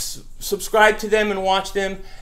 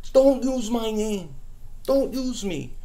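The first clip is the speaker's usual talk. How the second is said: mimicking the troll; slower than usual. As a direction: parrot the impostor in a sneering put-on voice. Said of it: "talking like that".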